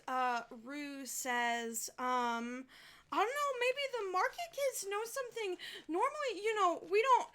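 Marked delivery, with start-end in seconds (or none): 3.1-7.3 put-on much higher and quick, a boy thinking aloud; eager little boy. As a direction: rush it out like a keen kid. Boy suggests asking market kids.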